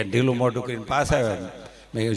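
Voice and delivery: ordinary talk, nothing marked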